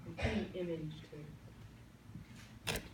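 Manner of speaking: calm meditation voice with long pauses; guided meditation. Acted. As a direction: lead them gently into stillness.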